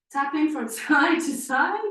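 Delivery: real laugh breaking into the instruction; amused, losing it mid-cue. Real, not acted. Laughing at own attempted accent.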